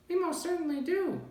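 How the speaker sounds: very high piping squeak; high squeaky yes; piping tiny voice. A narrator's reading. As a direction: pipe it up like a tiny Who. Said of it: "piped the voice".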